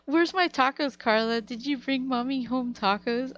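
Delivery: high, loud mom imitation; teasing, demanding mom. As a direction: needle your daughter in mommy's voice. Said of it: "My mom's like".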